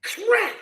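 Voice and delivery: sharp high loud crack sound; imitated gunshot crack.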